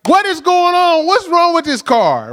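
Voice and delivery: high, exasperated outburst, retold; losing it. Driver to a car that won't start, acting out losing it. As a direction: blow up at the stubborn car. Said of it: "I lost it".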